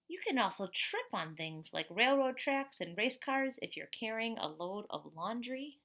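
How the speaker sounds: put-on lively storybook voice for kids; reading to children. A narrator's reading. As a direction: read it out to the class like a picture book. Reads picture book to class.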